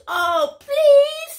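high, loud, desperate begging; pleading blue monkey. Acted. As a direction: beg in a squeaky monkey voice. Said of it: "says Blue Monkey".

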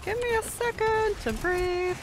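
high, slow put-on voice, stretched out; high, slow mock plea. Gamer repeating his plea in a silly high voice. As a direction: beg for a breather in a silly high voice.

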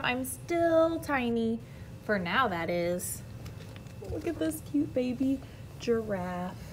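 ordinary delivery

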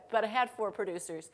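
ordinary delivery

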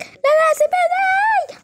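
high squealing let me go, the last word stretched slow; little sister squealing in a play fight to be let go; mock pleading scream. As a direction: squeal to be let go in a hammed-up struggle.

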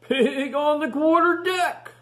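high, loud slow pirate call; calling first mate. Acted. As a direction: call it across the deck. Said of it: "called First Mate Scratch Whiskers".

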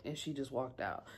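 ordinary talk, nothing marked

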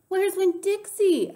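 high, drawn-out alarmed shout; loud, high, slow cry. A narrator's reading. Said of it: "I shouted".

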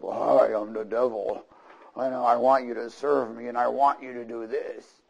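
hammed-up devil introducing himself. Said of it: "come up to you in the voice of".

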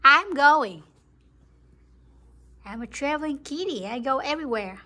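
loud, fast cat voice; mock cat demands; voicing the cat. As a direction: speak as the bossy cat. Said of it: "He said".

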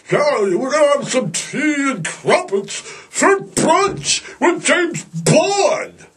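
high, slow, put-on British voice; mock British accent. Teasing the British craze over tea and crumpets, in a mock British accent. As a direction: ham up a posh British accent. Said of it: "he's so British".

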